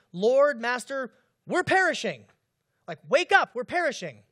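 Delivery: loud, urgent plea to a sleeping master; desperate cry. Retold or imitated. Disciples waking Jesus in the storm, loud urgent cry. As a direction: cry out for help as the boat sinks.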